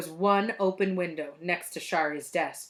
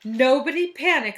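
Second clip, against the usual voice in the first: raised, higher announcement; calling for calm; 5 semitones higher, 7 dB louder than usual. A narrator's reading. Miss Mush addresses the startled class, calling out. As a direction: call it out to settle a room.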